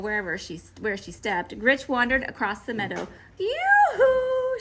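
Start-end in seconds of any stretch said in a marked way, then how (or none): 3.4-4.6 high, loud sing-song call; calling yoo-hoo. A narrator's reading. Grinch calls across the meadow, loud and high. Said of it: "she shouted".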